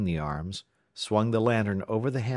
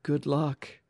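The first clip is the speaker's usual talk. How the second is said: high, tight, emotional farewell; understated choked-up send-off; catch in throat; 8 semitones higher than usual. A narrator's reading. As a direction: wish them luck with feeling. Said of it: "sudden catch in his throat".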